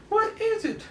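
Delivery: put-on very high, loud excited kid voice; squeaky sidekick. Rick Jones cheering the doctor, in a very high, loud squeaky voice. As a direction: squeal it like an eager boy sidekick.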